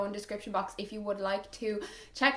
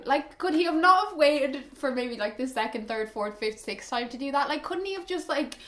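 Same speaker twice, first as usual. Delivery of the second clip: ordinary delivery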